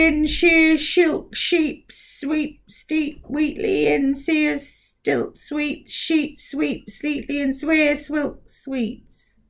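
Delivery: slow, high, strange mouth voice; a giant mouth drawing out a twister; slow recited twister. Acted. Giant mouth recites its twister slowly.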